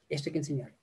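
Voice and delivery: "Esto hay que enseñar" repetido mucho más suave; repetido en voz baja. Shown as a demonstration. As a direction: baja la voz para recalcarlo. (eco suave y bajo)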